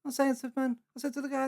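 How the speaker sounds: ordinary talk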